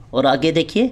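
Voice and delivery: normal talking voice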